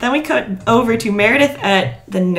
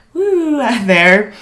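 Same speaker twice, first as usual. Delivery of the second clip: spaced-out; 3 semitones higher, 4 dB louder, much slower than usual. Retold or imitated. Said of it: "they all seem kinda like".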